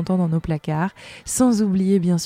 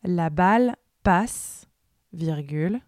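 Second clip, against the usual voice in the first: dictating; 6 dB quieter than usual. Acted. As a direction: read it out like a school dictée, word by word. Dictée read aloud with punctuation.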